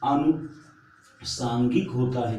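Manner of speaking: अनुषांगिक said slowly with the long आ clear; slow careful word. Shown as a demonstration. Teacher to exam students, slow demo of अनुषांगिक. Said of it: "छोटा सा नहीं होता है".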